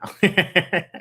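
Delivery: short high laugh. Real, not acted. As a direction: crack up at your own joke. Laughing at refurbished joke.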